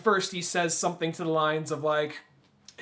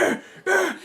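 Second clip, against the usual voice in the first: mock angry grunts; 13 semitones higher, 3 dB louder than usual. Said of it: "Just like".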